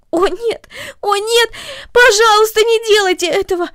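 put-on high, loud, pleading girl building in panic; begging in terror, rising with each plea; frightened cry. Cornelli crying out to the mother not to touch her hair, panicked pleading. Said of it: "закричала Корнели".

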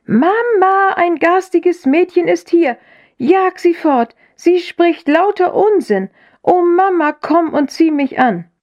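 put-on high-pitched child's wail for Mama; crying out. A narrator's reading. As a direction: call out in sustained high indignation, not full volume. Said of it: "aus vollem Halse schreiend". (hohes, klagendes Kindergeschrei)

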